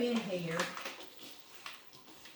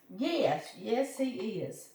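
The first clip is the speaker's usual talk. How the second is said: usual speaking voice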